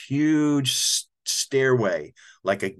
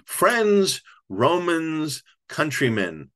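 put-on hammy old actor; 5 semitones higher, much slower than usual. Old actor faking Shakespeare for El Gallo, hammy declaiming. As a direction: ham it up like a flustered old actor. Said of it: "makes up a speech consisting of bits".

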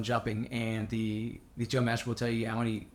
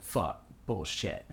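disgusted groan; 5 semitones lower, 3 dB quieter, much slower than usual. Retold or imitated. Frustration at wind call.

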